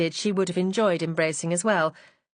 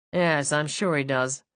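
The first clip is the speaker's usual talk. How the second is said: low, slow dry sneer; husband scoffs at the rival's note; lightly voiced dry sarcasm; sardonic; 4 semitones lower, slower than usual. A narrator's reading. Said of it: "Ignoring his tone".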